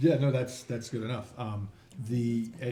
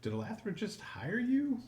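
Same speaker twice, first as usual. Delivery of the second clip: surprised barman; 11 semitones higher, slower than usual. Acted.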